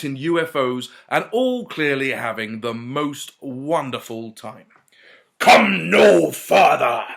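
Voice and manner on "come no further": loud, slow stern order; commanding demand. A narrator's reading. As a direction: halt them with authority. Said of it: "demanded Mowbray".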